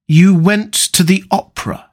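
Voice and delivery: measured, hurt reproach; pained, slow. A narrator's reading. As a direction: speak slowly, as if it pains you. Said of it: "very slowly and with a strained touch of pain".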